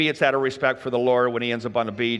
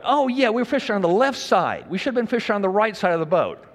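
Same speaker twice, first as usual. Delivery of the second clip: sarcastic retort; 6 semitones higher than usual. Retold or imitated. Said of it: "they're gotta be going".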